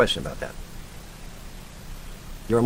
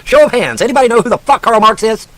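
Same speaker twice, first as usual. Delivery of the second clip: ordinary delivery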